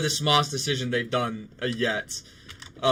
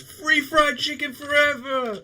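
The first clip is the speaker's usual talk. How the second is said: much higher, slow, hyped streamer yell; Speed impression; 11 semitones higher than usual. Remembering the streamer's catchphrase, a high slow hyped yell. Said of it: "Speed goes".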